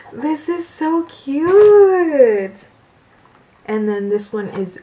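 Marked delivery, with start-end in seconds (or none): 0.1-2.6 high, drawn-out delighted squeal; delighted cooing. Real, not acted. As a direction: coo over something adorable, stretching the words. Cooing over new gift.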